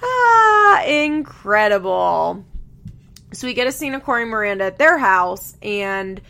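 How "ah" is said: high, loud "ah" of delight; delighted cry. Real, not acted. Host relishing Leah's screaming, loud delighted cry. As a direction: let out a long, high, happy cry.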